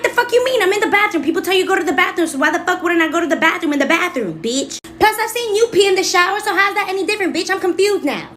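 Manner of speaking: put-on high defensive child voice; indignant kid. Little [name] defends herself to sister, high indignant. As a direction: argue back like a righteous kid. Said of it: "I'm like".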